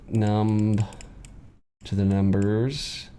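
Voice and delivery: slow, low chanting of numb to the numbers; drawn out like a slogan; slow mantra. Shown as a demonstration. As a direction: numb and numbers slowly stressed. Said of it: "numb yourself to those numbers".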